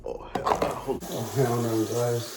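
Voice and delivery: strained, pained exclamations; mouth burning from spicy food. Real, not acted. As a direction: gasp it out with mouth on fire. Just finished the hot pepper.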